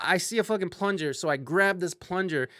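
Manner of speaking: normal talking voice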